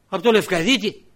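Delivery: loud, rising surprise; suddenly recognise the name; surprised loud question. Retold or imitated. Secretary general recognises the name.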